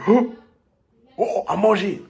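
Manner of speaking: high, loud cry of a starving glutton; greedy excitement. Retold or imitated. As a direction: gasp at food like you've never seen any. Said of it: "T'es comme ça". (cri de goinfre surexcité)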